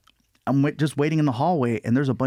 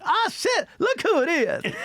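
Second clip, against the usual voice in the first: imitating Chris Rock; slower than usual. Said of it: "he's like".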